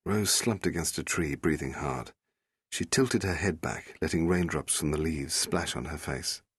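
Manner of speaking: ordinary delivery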